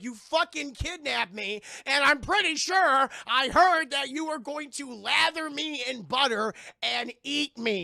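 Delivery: loud, high-pitched acted accusation; indignant mock yell. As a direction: yell back at your captor, hammed up. Captive yells back in retold quarrel.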